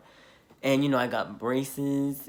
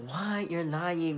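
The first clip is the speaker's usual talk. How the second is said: higher-pitched, incredulous reaction; lightly acted shocked friend; imitated disbelief; 5 semitones higher, slower than usual. Friends hearing about the punch, imitated disbelief.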